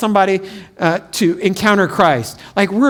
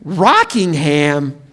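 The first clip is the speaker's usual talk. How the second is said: skeptical disbelief; 4 semitones lower, much slower than usual. Pretend. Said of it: "You'd be like".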